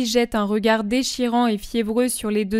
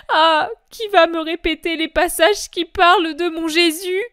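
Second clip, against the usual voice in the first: tearful, rising panic; faltering, tearful mother's voice; frantic Mary; 8 semitones higher, 5 dB louder than usual. Acted. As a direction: her reason slipping, pleading for help. Mary in distress, near tears.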